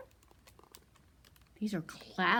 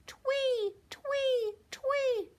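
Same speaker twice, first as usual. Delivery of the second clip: very high chirpy tweets; bird chirp for kids to copy; 3 dB louder, much slower than usual. Shown as a demonstration. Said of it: "Let me hear you say".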